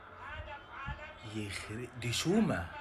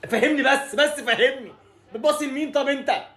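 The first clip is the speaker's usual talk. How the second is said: higher, laughing through the words; laughing in disbelief; 9 semitones higher, 4 dB louder than usual. Real, not acted. Fan laughing at Taher's bad pass, disbelieving laugh.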